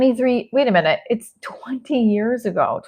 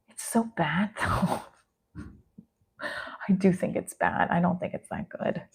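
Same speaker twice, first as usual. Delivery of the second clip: hushed; 3 semitones higher, 13 dB quieter than usual. Real, not acted. Hushed embarrassed aside.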